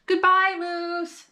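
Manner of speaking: high, drawn-out farewell; a child's high goodbye to her dog; girl's sad goodbye. A narrator's reading. Girl says goodbye to dog.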